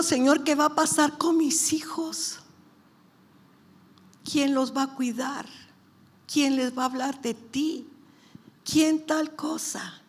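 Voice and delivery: high-pitched string of worried questions; anxious retold plea. Mother asking the Lord about her children, anxious high plea. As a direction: plead upward, acting out a worried parent.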